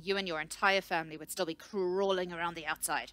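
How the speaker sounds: loud, heated retort; hurled back. A narrator's reading. Researcher's calm shatters at the scout, hurling it back. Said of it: "She hurled back".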